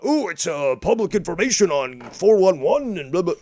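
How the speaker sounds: high, loud mocking voice; mocking rival. Mimicking Scott's excuse about public info, high and loud. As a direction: sneer out his excuse in a put-on voice. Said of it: "that's what you are constantly talking about".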